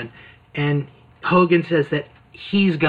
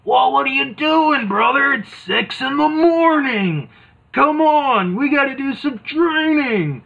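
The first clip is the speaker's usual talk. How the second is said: much higher, loud scolding wrestler; hammed-up tough coach; Hulk Hogan; 11 semitones higher, 4 dB louder than usual. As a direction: push him out of bed, brother. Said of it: "Hogan's like".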